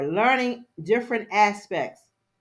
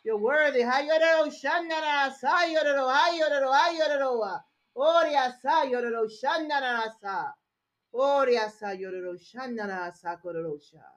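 ecstatic chanted tongues; speaking in tongues; 7 semitones higher, 3 dB louder than usual. Acted. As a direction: chant in tongues, rising fervor.

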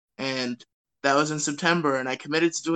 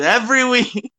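laughing; 9 semitones higher, 6 dB louder than usual. Real, not acted. Laughing repeat of every week.

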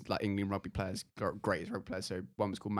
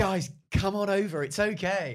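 coaxing mock call; 10 semitones higher, 5 dB louder than usual. Voicing the lone brave boy.

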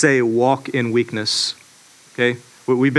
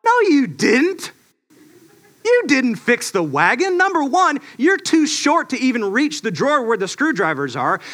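mock inner scoff; 8 semitones higher than usual. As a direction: tease the child in your head, hammed up. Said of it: "in my mind, I thought".

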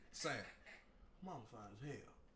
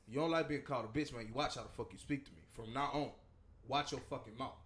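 usual speaking voice

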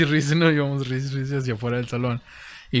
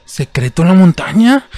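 eager teacher; 7 semitones higher, 13 dB louder than usual. Retold or imitated. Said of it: "¿Cuál es, profesor?".

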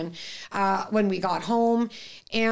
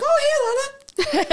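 high, bright-pitched imitation of a caller; loud, upbeat retold phone hello; enthusiastic greeting; 5 dB louder, much faster than usual. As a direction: play the vet chirping a greeting. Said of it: "hopefully that enthusiasm".

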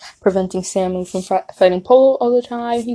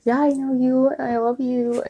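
retold tender reply; 4 semitones higher, 3 dB quieter than usual.

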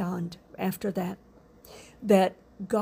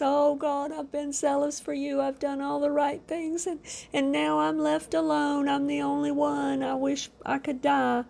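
higher, pleading lament; despairing Elijah; 5 semitones higher than usual. Retold or imitated. Elijah complaining to God in the cave, pleading and despairing. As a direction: pour it out like a worn-out prophet begging God. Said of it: "he says".

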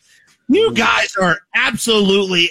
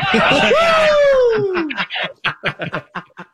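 big shouting laugh; 14 semitones higher than usual. Real, not acted.

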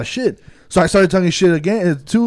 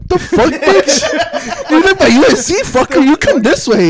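high, loud mock outrage; retold outraged refusal; 13 semitones higher, 6 dB louder, slower than usual. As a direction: shout back in playful disbelief, much higher. Acting out argument about pickup.

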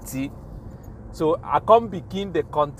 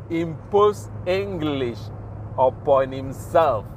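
the line said again, slowly, each word weighed; slow punchline repeat; slow deliberate repeat; slower than usual. Shown as a demonstration. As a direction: savour the phrase slowly. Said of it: "man, I like to repeat that line".

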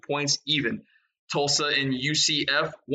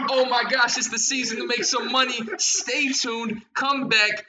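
hyped-up promo; 7 semitones higher than usual. Real, not acted. Hyping listeners before the break.

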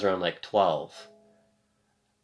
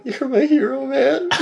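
put-on grateful kid; 6 dB louder, slower than usual. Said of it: "he's like".

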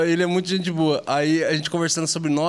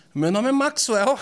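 laughing; 8 semitones higher, slower than usual. Retold or imitated. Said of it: "Ele falou".

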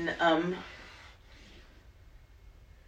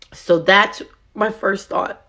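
normal talking voice

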